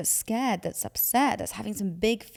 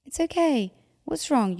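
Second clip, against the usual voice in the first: much higher, gently soothing; soothing a child; 7 semitones higher, 4 dB louder than usual. Retold or imitated. Parent comforting a crying child in the car, soothing. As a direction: coo it like a parent calming a kid. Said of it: "you're like".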